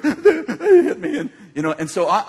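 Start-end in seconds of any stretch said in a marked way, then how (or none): 0.0-1.2 high, slow hammed-up sobbing words; mock tearful wail. Granddaughter blames her two brothers, crying in imitation. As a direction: wail it through gulping sobs like a child. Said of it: "she's still crying".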